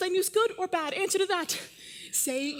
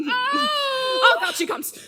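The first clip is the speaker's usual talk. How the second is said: excited; 5 semitones higher than usual. Acted. Juliet greets nurse eagerly.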